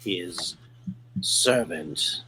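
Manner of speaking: slow, low alien voice; drawn out, low and menacing. Acted. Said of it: "this strange sort of alien type voice".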